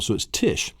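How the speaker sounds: ordinary delivery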